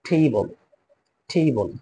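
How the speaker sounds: টেবল with an ei glide, not টেবিল; টেবল, ei vowel and short final ol; say teibol. Shown as a demonstration. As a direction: table pronounced টেবল. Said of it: "টেবিল না বলে আমরা বলবো টেবল".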